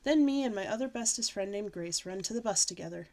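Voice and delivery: ordinary delivery, nothing marked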